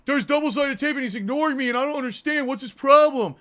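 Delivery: high, loud whiny mock voice; whiny mocking chatter. Streamer mocks toxic chatters, high and whiny. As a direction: mimic the nagging chatter.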